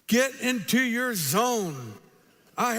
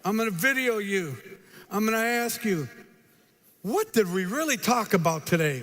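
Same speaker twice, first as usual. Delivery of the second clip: normal talking voice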